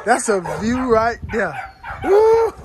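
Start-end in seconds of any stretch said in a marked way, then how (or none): 2.0-2.5 a high, loud whoop of joy; excited whoop. Real, not acted. Thrilled at the view, he lets out a loud high whoop. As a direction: whoop it out, pumped up.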